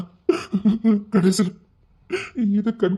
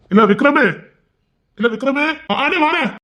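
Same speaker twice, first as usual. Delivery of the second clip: calling out; 9 semitones higher, 4 dB louder than usual. Acted. Calling out to Vikram.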